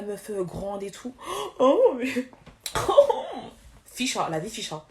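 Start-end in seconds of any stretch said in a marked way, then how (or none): 1.6-2.0 shocked gasp. Real, not acted. 2.5-3.5 high burst of laughter. Real, not acted. Bursts out laughing mid-rant.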